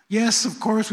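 ordinary talk

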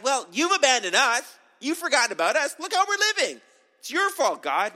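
put-on high, loud whiny complaint like a child; whining child. Gideon blaming God like a sulking child, loud whine. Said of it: "he kinda whines to him".

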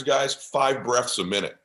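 ordinary talk, nothing marked

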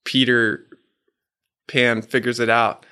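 ordinary delivery

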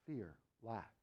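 ordinary talk

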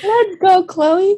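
high laughing cheer; excited cheer. Real, not acted. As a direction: cheer her on through laughter. Cheering friend's pronunciation.

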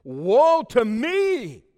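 very slow, loud, high anguished wail; anguished cry. Acted. Prophet before God's throne, slow anguished wail. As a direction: cry out in despair. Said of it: "I cried".

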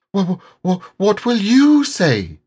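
high, stammering, frightened; stammering Piglet. A narrator's reading. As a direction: trip over the first words in fear.

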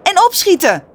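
high, loud yell; urgent high-pitched hurry-up. A narrator's reading. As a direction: yell it after him, pitched right up. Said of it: "gilt Chucky erachteraan".

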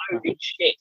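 high, hushed holy shit; sudden high astonished whisper; stunned. Retold or imitated. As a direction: gasp it out in disbelief. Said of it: "I'm like".

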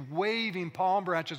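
ordinary talk, nothing marked

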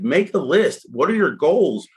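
high, lightly voiced lecturing mom; a hint of a tough-love mother laying out the rules; sounding like my mother. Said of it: "I sound like my mother".

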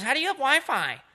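put-on high squeaky old woman asking; old lady voice. Old lady on the plane pestering him, high and squeaky. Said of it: "She goes".

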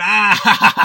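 best man laughing at his own toast, loud guffaw; drunk guffaw. Pretend. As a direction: laugh at your own crude joke. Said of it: "drunk best man douchebag".

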